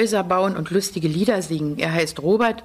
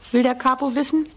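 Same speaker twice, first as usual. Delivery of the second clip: usual speaking voice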